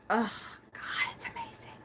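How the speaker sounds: breath-only rapturous whisper; awed whisper. Real, not acted. Vlogger savoring a new oil's smell, awed whisper. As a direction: whisper it, overcome by the scent.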